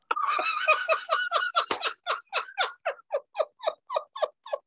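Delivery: high, breathless laughter; helpless laugh. Real, not acted. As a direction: burst out laughing, can't stop. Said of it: "Sorry, I'm laughing".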